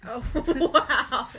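loud, laughing wow; real surprise. Real, not acted. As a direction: blurt it out through a laugh. Reacts to heroin news.

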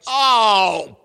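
loud, high mock-sarcastic "oh perfect", half laughing; sarcastic exasperation. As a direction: roll your eyes out loud at yet another chore. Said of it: "I'm like".

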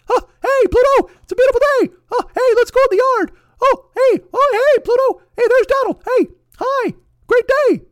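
piercing high falsetto, loud cartoon squeak; Mickey Mouse. Calling to Pluto about a beautiful day, squeaky glee. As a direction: squeal it like a relentlessly happy cartoon mascot. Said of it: "Shut up, Mickey".

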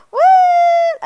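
high, loud laughing "What?"; laughing shriek. Real, not acted. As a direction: shriek it through real laughter. Laughing at her own accent.

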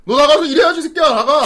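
loud, high-pitched telling-off; mock angry scolding. Sergeant sending a junior back to work, acted angry scolding. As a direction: order the junior out in mock outrage.

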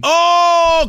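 loud teasing oh; big mocking ohh; surprised whoop. Real, not acted. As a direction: hoot at the innuendo. Reacts to double bat joke.

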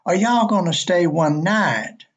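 light imitation of a small-town Southern clerk; Southern drawl. Clerk spelling it out for a confused guest, light Alabama accent. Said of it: "Could you say that again?".

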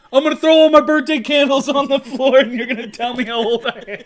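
loud, high, laughing through it. Real, not acted. Host joking about birthday candles, laughing loudly. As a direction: giggle the whole line out.

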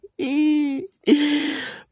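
a high squealing laugh. Real, not acted. Laughing at her own confession to the camera.

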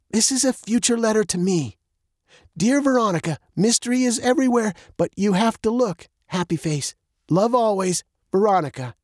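put-on high-pitched girl reading her letter; Veronica reading aloud. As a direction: read your own note out, phrase by phrase.

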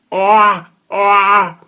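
loud high toad croaking. Acted. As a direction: croak insistently after her.

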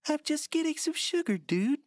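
very high put-on voice; young friend. Friend defending the brother at a party, very high mock nonchalance. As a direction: explain it in a squeaky kid-cartoon voice. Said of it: "He's like".

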